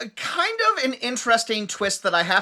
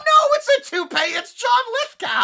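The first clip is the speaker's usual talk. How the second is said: much higher, hammed-up shocked announcement; mock dramatic reveal. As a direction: overplay the big reveal. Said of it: "it's all like".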